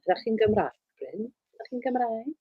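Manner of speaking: Welsh question asked lightly to a fan; a quick line of Welsh, Ydych chi'n Gymraeg; speaking Welsh. Shown as a demonstration. Asks a fan in Welsh, lightly spoken. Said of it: "Little bit of Welsh there for you".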